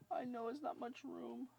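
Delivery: very high, slow cooing. Real, not acted. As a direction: coo it slowly to the puppy. Repeated baby talk, higher.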